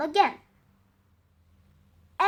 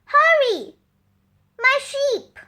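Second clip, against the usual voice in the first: high, loud hurried shout; urgent call; 9 semitones higher, 4 dB louder than usual. Acted. Sam hurrying the villagers along, high loud call. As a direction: push them to run.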